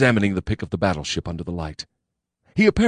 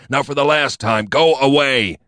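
louder irritated dismissal; 8 semitones higher, 6 dB louder than usual. A narrator's reading. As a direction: snap it out loud. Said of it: "he said".

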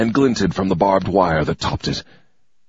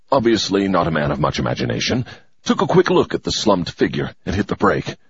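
ordinary delivery

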